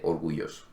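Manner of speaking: orgulloso with a vowel I replacing the ll. Shown as a demonstration. Teacher shows what most countries don't say, I for Y. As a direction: say orgulloso as or-goo-ee-oh-so. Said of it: "en ninguna de ellas se dice".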